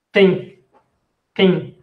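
southern French "Tin" with a sounded ng; joking Marseille accent on "Tin"; southern French joke. Shown as a demonstration. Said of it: "en el sur dicen pain".

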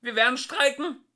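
high, loud threat yelled; shouting. A narrator's reading. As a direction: yell the threat. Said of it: "schrie Frumpf von Deel".

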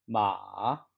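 마 dipping low, then rising; third tone. Shown as a demonstration. Teacher models tone three for students, dipping 마. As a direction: let 마 sink down and climb back up. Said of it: "삼 성".

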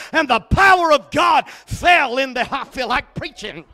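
loud, ringing preacher's climax; shouted revival preaching; full-force preaching. Acted. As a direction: preach it hard to the congregation.

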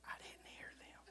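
wife's reply in a whisper; whispered quote. Retold or imitated. Wife whispering she didn't hear the men. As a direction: hush it like a wife leaning in. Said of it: "Susie looked for it and said".

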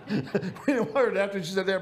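laughter breaking into the words; laughing. Real, not acted. Preacher remembering the family hiding their tears, laughing. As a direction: talk through a laugh, pitch lifted.

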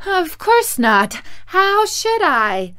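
high, slow, loud reply; Dorothy's high, drawn-out protest; distressed little girl. A narrator's reading. Frightened Dorothy answers the witch.

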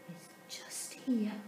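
unvoiced hushed whisper. A narrator's reading. Narrator leans in to the children, whispering. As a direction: whisper it like a secret.

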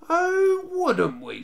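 very high, loud mocking "oh"; exaggerated taunting comeback; sneering. A narrator's reading. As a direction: sneer back with a swooping drop in pitch. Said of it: "sneered the Lone Ranger".

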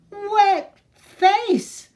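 high, loud whiny pout. Acted. Baby duck sulks to her parents about rain on her face, high pouting whine. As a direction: sulk it out like a cross toddler. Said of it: "pouted Baby".

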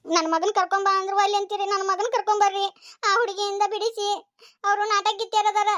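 high-pitched, upset wailing complaint; tearful pleading. Acted. Mother begs to get her son back, tearful and high. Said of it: "ಈಗ ಎದಕ್ ಅಳಾಕತ್ತಿ".

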